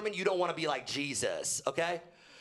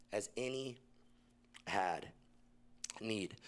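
"As any... had... need", each word spaced out, soft; slow word-by-word repeat; 4 semitones lower, 7 dB quieter, slower than usual. Shown as a demonstration. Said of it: "repeating words slowly".

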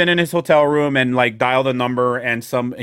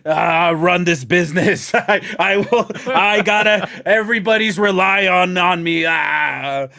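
mock-strained, higher-pitched declaration; overburdened performer; 4 semitones higher than usual. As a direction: play a struggling lone worker, hammed up. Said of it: "Yeah, it's like".

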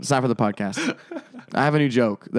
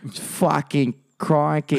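put-on Australian accent; 3 semitones higher, much slower than usual. Mock Australian exclamation.

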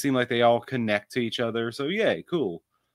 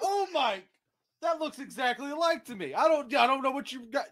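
incredulous; 11 semitones higher, 5 dB quieter than usual. Real, not acted. Disbelief at friends.

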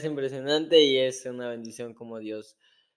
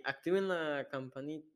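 attempted catchphrase; 2 semitones higher, 7 dB quieter than usual. Shown as a demonstration. Cohost trying to copy the bell catchphrase, raised botched attempt. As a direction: pitch the catchphrase up, then break off. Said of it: "no me sale".

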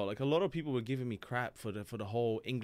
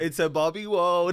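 mock girly singsong; 4 semitones higher, 11 dB louder, slower than usual. Joking about bringing girls home.

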